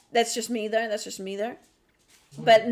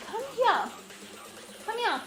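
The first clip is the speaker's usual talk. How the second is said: very high sing-song come here; calling the dog; 11 semitones higher, 5 dB quieter than usual. Real, not acted. Streamer calling her diva dog, very high and coaxing. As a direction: call her like a puppy, high up.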